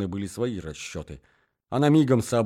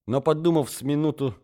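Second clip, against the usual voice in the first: ordinary delivery, nothing marked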